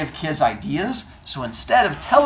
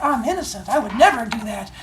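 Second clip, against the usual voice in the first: put-on innocent kid; 8 semitones higher than usual. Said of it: "and he's like".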